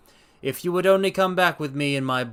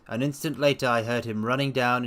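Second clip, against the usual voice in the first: ordinary delivery, nothing marked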